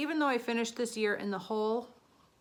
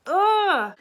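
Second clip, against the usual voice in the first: loud high exasperated ugh; frustrated ugh; 10 semitones higher, 12 dB louder than usual. Real, not acted. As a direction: groan out loud in dismay. Frustrated groan, found item.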